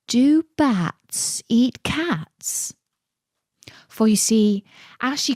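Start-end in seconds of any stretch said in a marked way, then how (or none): 0.1-2.7 very slow, dreamy and drifting. A narrator's reading. Alice dozing off, repeating herself in a slow dreamy murmur. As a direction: say it like you're nodding off. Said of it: "in a dreamy sort of way".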